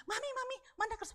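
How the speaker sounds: high-pitched imitation of a child; child calling mother. Child asking mother for the paper, high and pestering. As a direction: pipe up like a kid asking Mum. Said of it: "Anaknya bilang".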